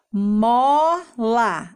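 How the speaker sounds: "Mola" stretched as mooo... la; two long beats in "Mola"; syllable by syllable. Shown as a demonstration. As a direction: piece "Mola" together syllable by syllable. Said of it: "vamos juntar e formar a palavra".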